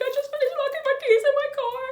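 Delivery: very high, loud, fast tearful whine; crying whine. Retold or imitated. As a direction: wail it like you're about to burst into tears. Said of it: "I was, like, crying".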